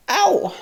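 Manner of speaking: sharp high yelp; quick high ouch; yelp of pain. Real, not acted. Burnt by candle wax.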